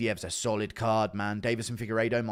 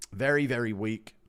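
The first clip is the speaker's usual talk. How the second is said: ordinary talk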